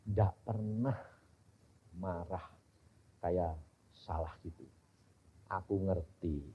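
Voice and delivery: low, soft and tender; moved to tears. Retold or imitated. Preacher recalling weeping before the gentle Lord, low and soft. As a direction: speak it as if near tears. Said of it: "Nangis aku".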